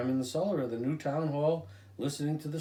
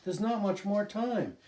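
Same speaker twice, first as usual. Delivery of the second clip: urgent; 7 semitones higher than usual. A narrator's reading. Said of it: "shouted the little boy".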